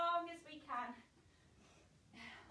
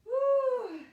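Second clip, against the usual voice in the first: strained whoop; 8 semitones higher, 14 dB louder than usual. Real, not acted. Holding wall sit, straining.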